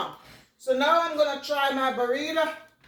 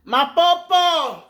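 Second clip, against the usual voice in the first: excited shout-out; 4 semitones higher, 8 dB louder, slower than usual. Real, not acted. Shouting out subscriber names.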